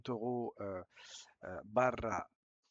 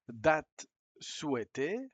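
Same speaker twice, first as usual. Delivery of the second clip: slow reading; 4 semitones higher, 6 dB louder, much slower than usual. Shown as a demonstration. Teacher reading the booking form aloud, slow and clear. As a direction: read slowly and a bit louder.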